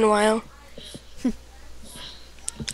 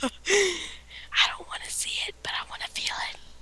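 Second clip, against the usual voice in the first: creepy whisper; hushed and suggestive; 8 semitones higher, 10 dB quieter than usual. Pretend.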